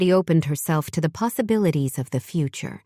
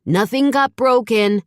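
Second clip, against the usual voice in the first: yelling down; 6 semitones higher, 5 dB louder than usual. A narrator's reading. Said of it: "Zach yelled down".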